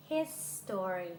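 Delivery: His story with a full, clear STO-ry; three-syllable his story. Shown as a demonstration. Teacher shows the contrast, His story in three syllables. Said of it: "ถึงแม้จะเชื่อมเสียงให้ติดกัน".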